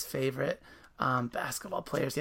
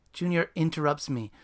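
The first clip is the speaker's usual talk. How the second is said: lively narration of a novel; story reading; 4 dB louder than usual. A narrator's reading. Host reading the book aloud after the author. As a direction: read the passage with energy.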